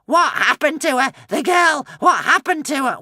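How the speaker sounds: high loud frantic demand; frantic shouting. A narrator's reading. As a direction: shout at him desperately. Witch frantic.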